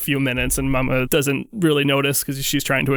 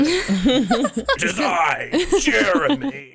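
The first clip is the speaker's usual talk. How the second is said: slow, high hammy demon voice; 8 semitones higher, much slower than usual. Pretend. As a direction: ham up a menacing demon. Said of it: "my demon voice".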